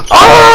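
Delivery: sudden high "Ah!" yell; hyped reaction yell; loud high whoop. Real, not acted. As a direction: burst out in a loud, high holler. Reacting to savage comeback.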